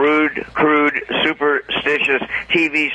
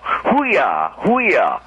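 retold swooping "hoo-yah" impression; Al Pacino; 3 semitones higher than usual. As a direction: imitate a sitcom character mimicking the movie star. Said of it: "take a look at Al Pacino?".

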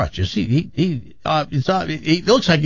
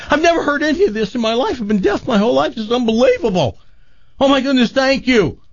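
much higher, bursting with wonder; amazed deaf man; 10 semitones higher, faster than usual. Acted. Healed deaf man to the bar, higher, overwhelmed with wonder. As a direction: marvel at every sound.

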